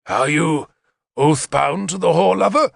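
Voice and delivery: menacing question, rising in pitch; dangerous voice. A narrator's reading. King questioning the enemy's man, dangerous tone. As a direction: threaten through the question. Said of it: "asked me in a dangerous voice".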